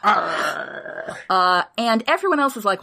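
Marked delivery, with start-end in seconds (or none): 0.0-1.2 North Country pirate. Retold or imitated. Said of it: "proper North Country accent where he's like".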